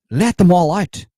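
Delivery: high shout. Pretend. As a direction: fire it off as a high rallying shout. Mock zealot rallying cry.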